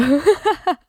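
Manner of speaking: bright burst of laughter. Real, not acted. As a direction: laugh out loud. Laughing about singing five songs.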